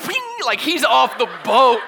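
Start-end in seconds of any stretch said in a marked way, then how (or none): 0.1-0.5 very high zinging sound effect; playful high-pitched zing; imitated leap noise. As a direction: make a high whizzing launch noise. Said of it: "And Peter's like".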